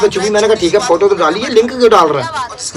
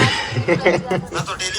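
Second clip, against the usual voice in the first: soft laugh; 10 dB quieter than usual. Real, not acted. Laughs at own jab.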